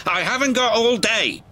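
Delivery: high-pitched cross complaint; impatient bark. A narrator's reading. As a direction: bark it grumpily. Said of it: "barked Cranky".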